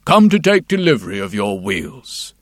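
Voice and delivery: lightly voiced stiff chamberlain; formal, low court official tone; stiff ceremonial announcing. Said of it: "said the chamberlain".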